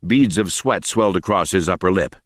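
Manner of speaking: ordinary delivery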